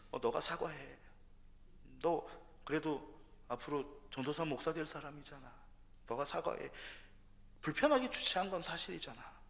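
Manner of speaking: soft, gentle urging voice; quietly nudging him to apologize; God's inner voice. Pretend. God gently telling him to apologize at dawn prayer, soft voice. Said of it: "마음에 자꾸 하나님이".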